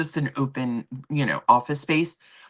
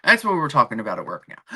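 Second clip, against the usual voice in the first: ordinary talk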